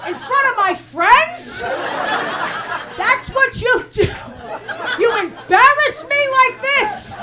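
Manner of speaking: put-on high, loud scolding old lady; outraged grandmother. Grandmother scolding her comedian grandchild after the show, high outraged yell. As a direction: shriek like a humiliated grandma. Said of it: "she goes".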